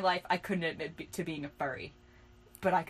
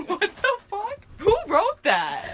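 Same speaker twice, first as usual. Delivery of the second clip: high, loud, incredulous through laughter; laughing disbelief; 9 semitones higher, 9 dB louder than usual. Real, not acted. Cracking up at own old writing.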